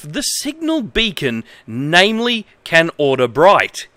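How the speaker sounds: mildly stilted reading of a badly translated manual; manual read-aloud. Streamer reading a bad manual to viewers, lightly formal. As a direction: read it out straight, a touch formal.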